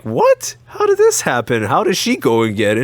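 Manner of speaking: high, loud incredulous cry; stunned at losing the prize; shocked disbelief. Retold or imitated. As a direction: squeal it out in disbelief.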